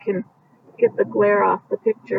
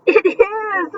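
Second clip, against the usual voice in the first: much higher glad call; shouted back; 10 semitones higher than usual. Acted. Farfalina confirming to Marcel, glad shout back. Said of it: "Farfalina shouted back".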